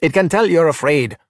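confident, commanding coach; with authority. A narrator's reading. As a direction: say it like you know best. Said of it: "Alberto said with authority".

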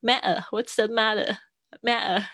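slow British "matter" with the t dropped to a glottal stop. Shown as a demonstration. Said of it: "英式发音会说matter". (英式口音，"matter"里的t不发音)

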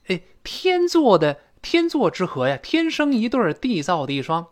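天作之合 said high and excited, rising pitch; excited exclamation. Acted. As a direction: cheer the match on with gusto. Exclaiming a perfect match. (兴奋地高声说天生一对)

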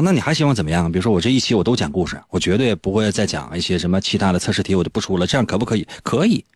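usual speaking voice

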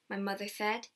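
usual speaking voice

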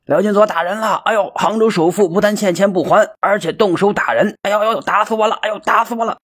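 high-pitched wailing holler, hamming up the pain; hollering rogue. A narrator's reading. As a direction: cry out to the crowd as if beaten to death. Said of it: "扯开嗓子吆喝道".

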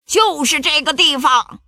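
high, emphatic pirate chief; pirate captain. A narrator's reading. Pirate captain pointing out the treasure spot, emphatic and high. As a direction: announce the find like a pirate boss. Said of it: "头目汉克指着岩石和椰树下的大坑说".

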